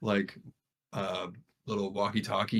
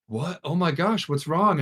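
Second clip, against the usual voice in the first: higher-pitched startled questions; alarmed questioning; 7 semitones higher, 3 dB louder than usual. Retold or imitated. Mom answers aunt's call, alarmed questioning. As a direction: imitate a mom suddenly worried.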